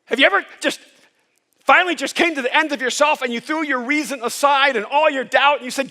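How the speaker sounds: normal talking voice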